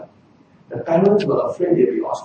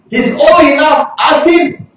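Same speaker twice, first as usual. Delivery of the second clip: high, louder brush-off; defensive deflection; 11 semitones higher, 4 dB louder, slower than usual. Retold or imitated. Parents deflecting the Pharisees to their son, high defensive. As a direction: push the question away.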